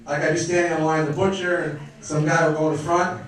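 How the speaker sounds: usual speaking voice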